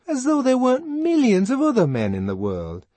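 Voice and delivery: very high, loud scoffing; exasperated protest. A narrator's reading. Fanny reminding Lenina of other men, exasperated. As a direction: pour out exasperation at top pitch.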